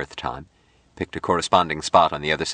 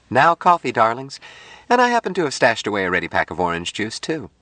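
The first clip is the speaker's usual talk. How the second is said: high, quick woman's voice; chirpy Jill character; 6 semitones higher than usual. A narrator's reading. Jill offers coffee and juice to her darlings, chirpy. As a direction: lilt it up high like a busy hostess.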